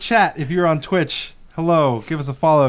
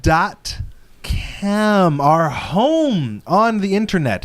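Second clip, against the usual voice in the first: pitched-up plug for the website; promo announcer read; 8 semitones higher, slower than usual. Acted. Host pitching the show website to listeners, announcer style. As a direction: sell it like a radio spot.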